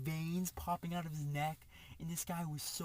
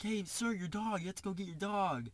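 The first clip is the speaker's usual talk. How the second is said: higher-pitched alert to a stranger; urgent heads-up, pitched up; retold calling out; 5 semitones higher than usual. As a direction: imitate calling over to someone, without shouting. Acts out calling to the driver.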